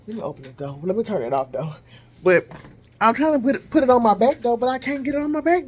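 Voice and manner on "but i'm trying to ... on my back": higher and louder while straining; strained, reaching behind your back. Real, not acted.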